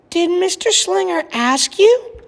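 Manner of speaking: high gentle mother; mother's voice. A narrator's reading. Mother gently asking Lily whether she was asked. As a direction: ask like a careful mom.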